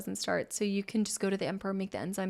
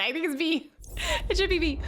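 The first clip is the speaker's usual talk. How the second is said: grand announcer voice; 7 semitones higher than usual. Shown as a demonstration. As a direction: announce B with gravitas. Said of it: "let me put some respect on it".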